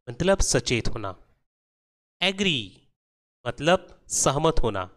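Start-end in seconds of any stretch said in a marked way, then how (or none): 2.2-2.6 एग्री with a light "uh" start, pitched up; schwa demo. Shown as a demonstration. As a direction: say एग्री as "uh-gree". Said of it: "ए को तेज नहीं बल्कि धीरे".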